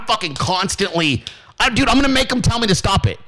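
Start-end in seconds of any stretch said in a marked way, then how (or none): none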